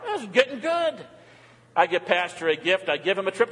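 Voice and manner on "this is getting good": higher, gleeful "This is getting good"; excited. Real, not acted. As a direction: grin through it, clearly thrilled now. Growing delight at gift list.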